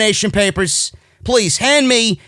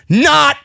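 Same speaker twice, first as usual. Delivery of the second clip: "not" pitched high and stretched as a blunt reversal; emphatic NOT; 6 semitones higher, 3 dB louder than usual. Real, not acted. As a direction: hit "not" high, then leave it hanging. Negates the wrestler's case.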